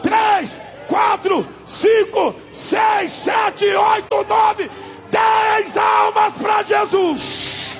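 high shouted count, last words stretched; triumphant counting cry building to a long finish; full-force preaching count. Real, not acted. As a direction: lead the crowd's count at a shout. Preacher counts converts aloud.

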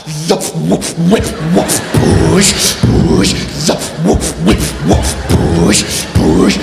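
very low gruff huffing chant; mock-macho grunted breaths and pushes; macho breathing style. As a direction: puff the breathing exercise like a macho man. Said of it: "macho style".

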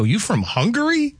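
high, wondering question; puzzled guess. Retold or imitated. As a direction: guess the accent, baffled. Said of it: "I go".

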